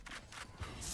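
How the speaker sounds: breathy evil villain cackle; a hammed-up evil laugh. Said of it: "don't laugh like that".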